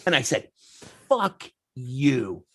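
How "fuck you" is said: measured, word-by-word insult; deliberate retort. Retold or imitated. Son repeats himself to the affronted woman, coolly defiant. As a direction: repeat it calmly, spacing out each word. Retelling him repeating his comeback.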